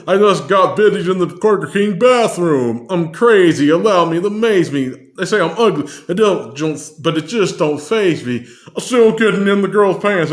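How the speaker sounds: impersonating [name]. Retold or imitated. Fan impersonating [name] rapping [name]. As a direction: rap it cocky like [name]. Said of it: "impersonate the voice".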